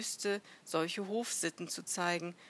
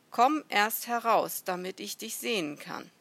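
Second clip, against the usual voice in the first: raised-voice command across a distance; calling out; 3 semitones higher, 8 dB louder than usual. A narrator's reading. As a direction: order someone out of hiding. Acted shouted exchange between strangers.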